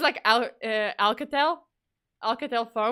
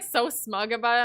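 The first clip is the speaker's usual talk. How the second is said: ordinary talk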